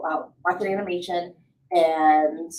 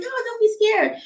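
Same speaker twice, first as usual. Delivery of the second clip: high, light comforting voice; high reassuring; 14 semitones higher, 4 dB louder than usual. Acted. Buddy reassuring a scared newcomer, high light voice. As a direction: soothe a friend in a lifted tone.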